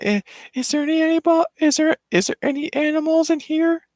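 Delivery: put-on high little-girl voice; Lilo. Little girl calling for animals at the shelter, high and searching. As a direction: call out in a very high, questioning child's voice. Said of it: "she's like".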